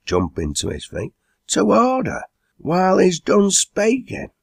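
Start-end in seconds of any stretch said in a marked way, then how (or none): 1.5-4.3 very high-pitched indignant call to order; crying out. A narrator's reading. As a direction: demand silence for the speaker. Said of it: "cried Rip".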